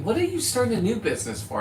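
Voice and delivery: light mock of bank staff; imitating bank staff. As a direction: poke fun at the puzzled clerk. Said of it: "They're going".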